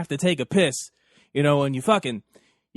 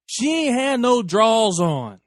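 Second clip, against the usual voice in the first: slow and louder for effect; dramatic reveal; 6 semitones higher, 4 dB louder, slower than usual. Real, not acted. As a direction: drag out the reveal for the punchline.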